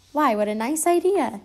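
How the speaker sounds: bright idea, happily seized on; exclaimed. A narrator's reading. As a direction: exclaim it with pleased surprise. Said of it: "exclaimed Pooh".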